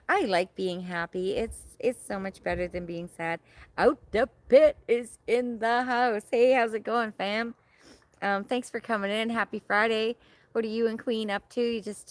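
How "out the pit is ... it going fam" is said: excited welcome. Real, not acted. Welcoming a new viewer.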